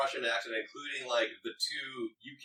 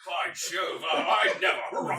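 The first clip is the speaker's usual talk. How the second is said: slow, high mock British gent; stuffy British accent; 5 semitones higher, 5 dB louder, much slower than usual. Friend joins in mocking stuffy Brits with British accent. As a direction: harrumph it like an old British aristocrat. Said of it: "stuffy Brits".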